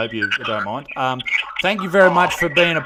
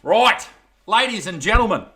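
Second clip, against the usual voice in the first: loud, higher hammed-up host voice; showman restart; 8 semitones higher, 7 dB louder than usual. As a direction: relaunch the show with gusto. Host back on camera, showman.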